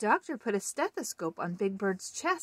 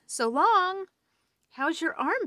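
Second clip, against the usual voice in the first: high, louder sing-song goodbye; chirpy farewell; 10 semitones higher, 5 dB louder than usual. Acted. Children leaving the doctor's office, high bright farewell. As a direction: call it out brightly. Characters leaving, Grover greeted.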